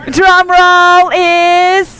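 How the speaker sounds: drawn-out, loud, high announcement; makeshift drum roll. Pretend. Host filling in for a missing drum roll, drawn-out suspense. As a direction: stretch each word to build suspense. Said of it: "we don't have a drum roll".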